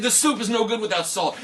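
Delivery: loud high grumble; annoyed diners; loud protest; complaining guests. Acted.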